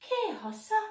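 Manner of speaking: high questioning call. A narrator's reading. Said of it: "ar son go".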